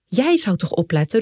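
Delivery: ordinary delivery, nothing marked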